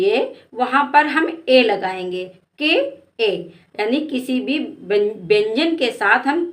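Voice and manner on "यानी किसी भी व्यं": ordinary talk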